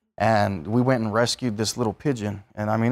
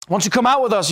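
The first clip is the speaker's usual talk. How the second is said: high, louder mock invite from friends; lightly voiced party buddies calling you out; mock friendly invite; 10 semitones higher, 5 dB louder, faster than usual.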